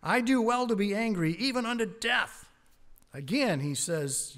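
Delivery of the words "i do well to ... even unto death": Jonah hammering back at God; defiant Jonah. Acted. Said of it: "And he said".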